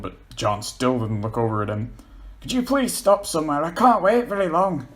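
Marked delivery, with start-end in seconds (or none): 2.4-4.9 pleading. Acted. Paul pleading, desperate.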